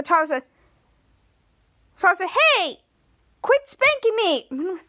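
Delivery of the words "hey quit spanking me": high, drawn-out mock complaint; acted protest. Toddler to Schwoz while being spanked, high indignant protest. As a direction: whine in protest, imitating the victim.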